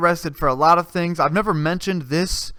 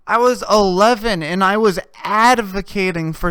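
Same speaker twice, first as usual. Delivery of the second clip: higher, louder, outraged rant; high indignant rant; 7 semitones higher, 3 dB louder than usual. Real, not acted. Comic ranting about finding out too late, high-pitched. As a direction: climb up in outrage.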